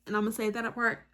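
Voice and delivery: normal talking voice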